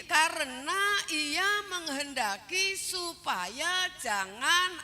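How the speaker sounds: slow, ringing pulpit delivery; emphatic scripture quoting. Acted. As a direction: draw out each word of the verse. Preacher expounds verse emphatically.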